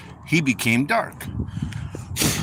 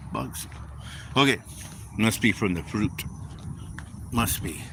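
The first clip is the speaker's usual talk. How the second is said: ordinary talk, nothing marked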